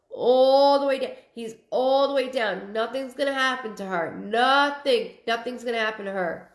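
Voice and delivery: firm, insistent reassurance, key words leaned on; emphatic reassurance. Real, not acted. Reader tells a viewer she will be okay, emphatically reassuring. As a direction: stretch and punch ALL and Nothing.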